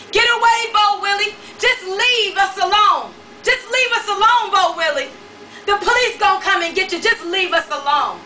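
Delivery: high, loud frantic shouting. A narrator's reading. Woman shouting at the man beating on her door. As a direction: yell him away from the door. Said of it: "he started shouting".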